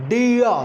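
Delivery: "dear" as "dee-uh", r dropped. Shown as a demonstration. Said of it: "It is never pronounced".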